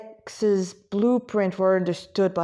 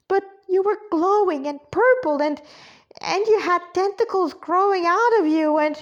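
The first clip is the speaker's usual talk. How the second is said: put-on high, excited little-girl voice; worked-up kid listing strange sights; childlike alarm; 9 semitones higher, 3 dB louder than usual. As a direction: protest in a high, insistent pipe. Child character protests in alarm.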